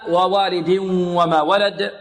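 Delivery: nasalised merge of ووالد into وما; إدغام بغنة. Shown as a demonstration. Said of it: "غنة، إدغام بغنة". (إدغام تنوين ووالد في واو وما بغنة)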